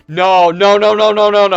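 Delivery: loud stunned no, no, no; shouting no in disbelief. Retold or imitated. To the store crowd, a loud stunned no. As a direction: shout it out, refusing to believe your luck. Disbelief at pulling rare card.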